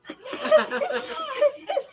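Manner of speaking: high, breathy giggle; high laugh. Real, not acted. Giggling after quoting the president's invitation. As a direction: giggle at the memory.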